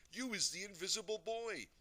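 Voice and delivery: high, loud, thrilled exclamation; squiffy with excitement. A narrator's reading. Daddy discovering his son's invisibility, very excited. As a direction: marvel aloud in delight. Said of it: "My daddy is squiffy with excitement".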